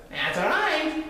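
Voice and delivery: high-pitched cat and duck; high cartoony animal voice; animal friends' voice. A narrator's reading. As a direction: pipe it up high like little animals.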